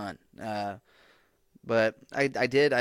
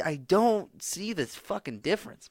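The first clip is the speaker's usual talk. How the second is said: higher, slower, baffled exclamation; exasperated; 8 semitones higher, 3 dB louder, slower than usual. Real, not acted. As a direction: stretch it out in disbelief. Baffled rant about phones.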